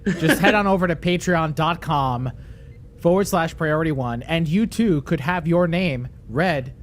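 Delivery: projected host pitch; podcast promo read. Acted. Host inviting captains to become patrons, promo read. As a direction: sell it to the listeners like an ad read.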